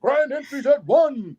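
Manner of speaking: loud, high announcer call; powwow emcee. Retold or imitated. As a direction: sing it out like a powwow MC. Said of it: "a powwow M C or announcer".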